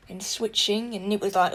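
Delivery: usual speaking voice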